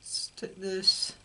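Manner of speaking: usual speaking voice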